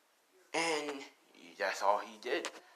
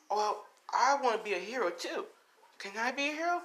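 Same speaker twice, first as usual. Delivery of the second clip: put-on high, hopeful child voice; eager young boy; 11 semitones higher than usual. Boy asking heroes to let him join, eager. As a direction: plead hopefully like a little kid. Boy begs to become a hero.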